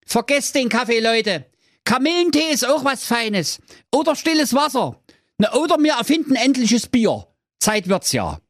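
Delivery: high-pitched fool rallying a crowd; foolish Thorsten calling out. Acted. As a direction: call out cheerfully, pitched high. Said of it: "rief der Thorsten". (hoch rufender törichter Thorsten)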